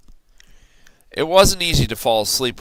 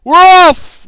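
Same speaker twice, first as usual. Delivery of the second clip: much higher, loud shout; crew shout; 15 dB louder than usual. A narrator's reading. Pirate crew setting sail, loud high shout. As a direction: whoop it as a crew. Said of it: "we all shouted".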